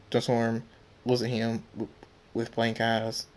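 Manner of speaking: ordinary delivery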